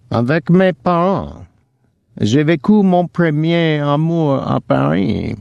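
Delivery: put-on high French with a rasping, poor accent. A narrator's reading. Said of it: "the accent rasping".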